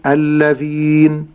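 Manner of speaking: الذين ending on a silent held n; pausal form. Shown as a demonstration. Sheikh showing الذين when pausing, long ee into bare n. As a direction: stop on الذين without the final vowel. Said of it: "أما في حال الوقف أقول".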